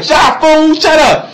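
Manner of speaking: high, loud mock yell; angry retort. Brother silencing his panicking sister, loud and annoyed.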